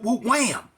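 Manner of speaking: high gang call; gang call imitation.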